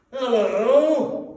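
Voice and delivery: loud, high, gruff call; mock growl. A narrator's reading. Scrooge pretending anger at late Bob, loud gruff call. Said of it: "growled Scrooge".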